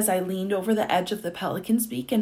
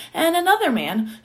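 ordinary delivery

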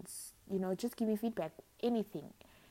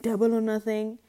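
loud, theatrical, odd voice; dramatic bold declaration; 3 semitones higher, 12 dB louder than usual. Pretend. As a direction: ham it up like a gambler. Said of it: "I have to do it".

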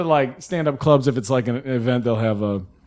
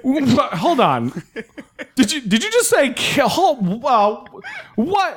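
stunned disbelief; 9 semitones higher, slower than usual. Retold or imitated. Comic reacting to the heckle.

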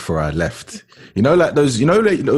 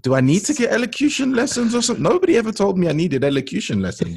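comic high-pitched indignation; incredulous; 14 semitones higher, 3 dB louder than usual. Retold or imitated. Student misunderstood at Cambridge retells her indignation, incredulous. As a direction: protest at the top of your pitch, playing for laughs. Retold disbelief, listeners laughing.

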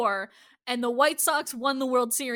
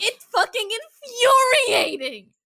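emphatic, exasperated venting; angry; 4 semitones higher, 4 dB louder than usual. Real, not acted. Host venting about a hated line, angry. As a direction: drag the words out in outrage. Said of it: "I'm angry".

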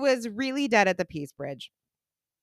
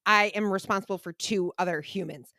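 normal talking voice

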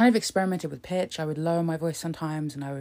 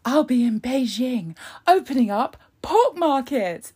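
higher, louder mock Truss quote; imitating Truss; 7 semitones higher, 6 dB louder than usual. Vlogger mimicking Truss's pork markets video, higher and louder. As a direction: parody her chirpy pork markets line. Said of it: "the pork markets video where she's like".

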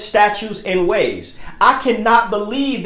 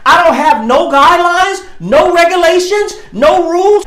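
rising, louder preaching; 5 semitones higher, 3 dB louder than usual. Acted. Preacher building to a loud climax on following God.

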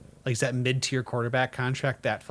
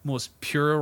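usual speaking voice

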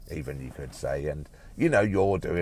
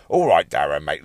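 loud; 13 dB louder than usual. Acted. Loud greeting after whisper.

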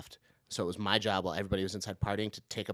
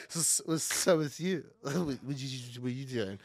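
put-on slow, slurred, mumbling drunk pickup line; drunk come-on; 3 dB quieter, slower than usual. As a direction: lazily slur it like a drunk hitting on a girl. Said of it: "drunkenly hitting on every girl".

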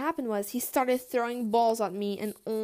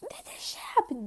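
disbelief; 10 semitones higher than usual. Retold or imitated. Disbelief at being noticed.

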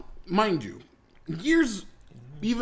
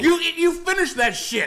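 high, raised mock threat; stern father; 9 semitones higher, 4 dB louder than usual. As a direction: imitate a dad ordering a kid, half laughing. Voices father threatening a smack.

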